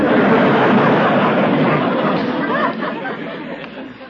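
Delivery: a long, high chuckle at his own joke; long high laugh. Real, not acted. Druggist laughing at his own story, long high laugh.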